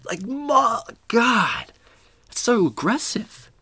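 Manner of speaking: high, drawn-out mock horror; exasperated dismay. Student reacts to all the red circles, in drawn-out mock dismay. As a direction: wail it slowly, way up high.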